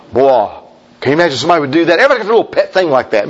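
ordinary talk, nothing marked

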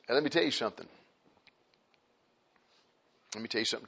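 ordinary talk

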